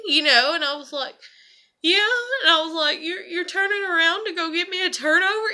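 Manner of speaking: overwhelmed, fighting back real tears; tearing up. Real, not acted. Wife recalling husband's kindness, crying for real.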